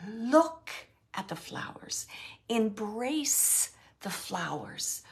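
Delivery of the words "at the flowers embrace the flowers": breathy hush on the phrase; whispered. Acted. Medium whispering the spirit's advice to the client. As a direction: lean in and whisper it.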